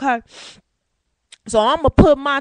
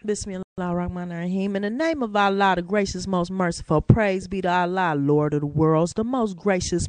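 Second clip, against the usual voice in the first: solemn recitation; 5 semitones lower than usual. Acted. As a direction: recite it gently like a prayer. Recites opening of Quran.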